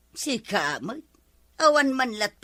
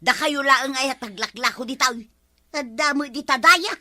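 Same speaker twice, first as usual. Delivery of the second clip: loud, high indignant Oho; indignant outburst; 4 semitones higher, 6 dB louder than usual. Acted. Customer snapping back at shopkeeper, loud and indignant. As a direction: flare up and shout back.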